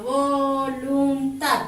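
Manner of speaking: "voluntad" drawn out slowly, syllable by syllable; split into syllables. Shown as a demonstration. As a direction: stretch each syllable of "voluntad". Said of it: "al separar en sílabas tenemos".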